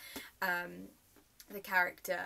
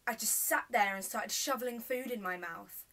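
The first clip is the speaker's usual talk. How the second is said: indignant, loud and higher-pitched outburst; furious rant; 6 semitones higher, 6 dB louder than usual. Acted. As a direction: build to heated indignation, then ease off. Monologue, furious at mother over meal.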